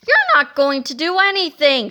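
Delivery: loud, cutting-off villain yell; villain scream. Acted. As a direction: shout him down mid-threat. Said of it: "screamed Sir Stinks-a-Lot".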